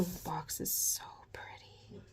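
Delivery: breathless whispered admiration; hushed delight at the box; awed whisper. Real, not acted. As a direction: whisper it like it's precious.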